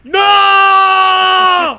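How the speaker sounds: huge high yell of mock dread; mock horrified shout. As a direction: scream no like it's a horror movie. Horrified at monster lineup.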